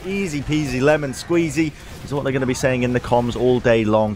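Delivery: ordinary delivery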